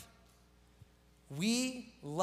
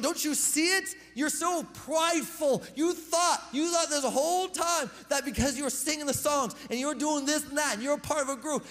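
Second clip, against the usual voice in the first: raised, pressing pulpit voice as Paul; preaching rebuke; 5 semitones higher, 4 dB louder than usual. Acted. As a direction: urge them to humble themselves.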